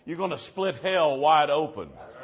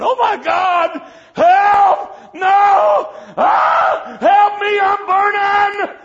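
high, loud panicked screams, dragging out burning; dying man in the hospital screaming in terror; terrified screaming; 14 dB louder, much slower than usual. Retold or imitated. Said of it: "He said that man starts screaming".

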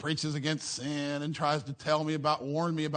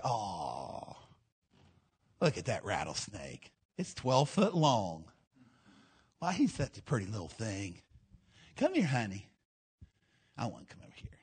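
softer, lower, sweet cooing to a snake; foolish admirer cooing; 6 semitones lower, 3 dB quieter than usual. Retold or imitated. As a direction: coax the snake like a pet, hushed. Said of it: "They just say".

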